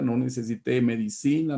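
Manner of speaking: ordinary talk, nothing marked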